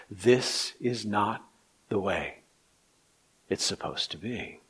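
low, soft, with long pauses; weighty, subdued pulpit voice; hushed grave preaching. Real, not acted. Hushed, grave passage of the sermon.

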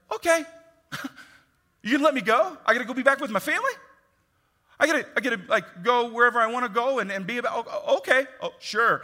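high, quick, eager to please; nervous eager compliance. Retold or imitated. Arrested person pleading to the rulers to be let go, eager and high. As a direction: babble agreement to get let go. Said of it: "some of us would be like".

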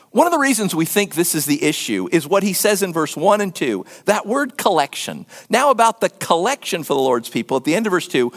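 normal talking voice

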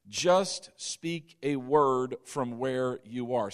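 slow, deliberate weight on each word of "Just speak a word"; emphatic, word by word. Shown as a demonstration. Preacher highlighting a powerful word to his listeners, slow and emphatic. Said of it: "Now notice this powerful word".

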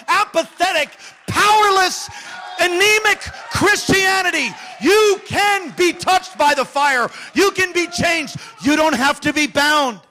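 loud, building sermon voice, slowing on key lines; full-force preaching. Acted. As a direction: preach it with fire.

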